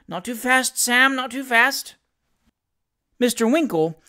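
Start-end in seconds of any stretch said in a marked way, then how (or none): 0.1-1.9 higher-pitched, mildly nervous caution; lightly acted pleading to slow down; anxious plea. A narrator's reading. Nervous skater to his helper, mildly anxious plea.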